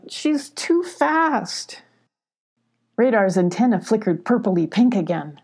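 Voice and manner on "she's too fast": high, very slow whine of defeat; acted high lament; distressed cry. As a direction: draw it out high and upset. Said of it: "Abby cried".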